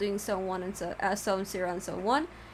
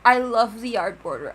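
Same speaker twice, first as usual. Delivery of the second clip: gushing excitement; 2 semitones higher, 9 dB louder, slower than usual. Real, not acted. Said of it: "one of my most favorite things".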